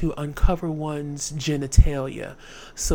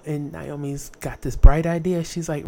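normal talking voice

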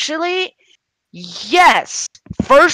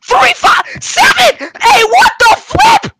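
high screaming outburst; berserk rage; 6 dB louder than usual. Real, not acted. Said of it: "Stop screaming".

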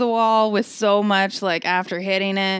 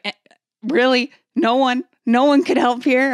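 incredulous crowd; 7 semitones higher, 7 dB louder than usual. Retold or imitated. Audience quoted in disbelief.

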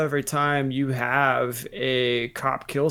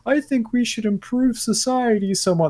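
earnest Renaissance man; 9 semitones higher than usual. Retold or imitated. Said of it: "and he's like".

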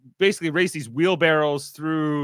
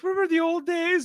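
mock sweet plea; 14 semitones higher than usual. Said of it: "She's like".

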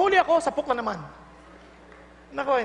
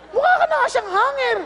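catty mockery; 9 semitones higher, 5 dB louder than usual. Mocking gossip.

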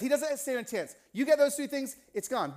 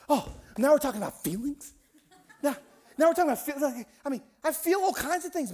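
high-pitched, indignant protest; exasperated objection; 6 semitones higher than usual. Retold or imitated. Skeptical friend balking at talk of feelings, exasperated.

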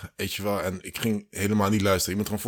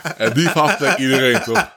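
words bursting through laughter, higher and louder; laughing; 7 semitones higher, 7 dB louder than usual. Real, not acted. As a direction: laugh your way through the punchline. Laughing while telling the payoff. (lachend, hoger en luider)

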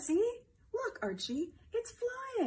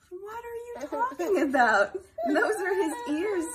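loud, laughing mock outrage; laughing protest; 8 semitones higher, 8 dB louder than usual. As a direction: protest through laughter, playfully. Mock argument duck vs rabbit.

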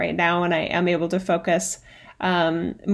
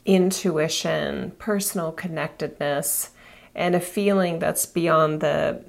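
guided meditation; 3 semitones lower, 3 dB quieter than usual. Acted. Guides elevator visualization meditation.

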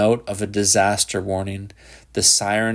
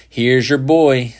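slow, louder, grim policeman; dour policeman; 4 semitones higher, 6 dB louder, slower than usual. A narrator's reading. Policeman brings the boy to Shepard, dour and louder. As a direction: hand him over with grim satisfaction. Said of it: "the dourest of the policemen said".

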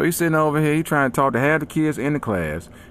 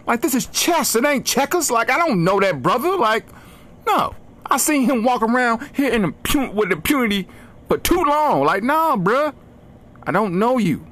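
Denzel rant; 10 semitones higher than usual. Retold or imitated. Comedian ranting about friend suggestions, high indignant Denzel voice. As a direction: rant like Denzel in Training Day.

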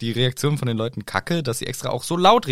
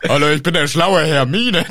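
louder mock clever-grown-up voice; Hermine; 3 semitones higher, 6 dB louder than usual. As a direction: introduce yourself as a smug know-it-all, playfully overdone. Spottstimme für erwachsenen Hermine. (albern verstellte Schlaumeier-Stimme)

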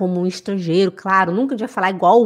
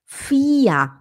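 fia whispered; whispered example. Shown as a demonstration. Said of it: "não é fia".

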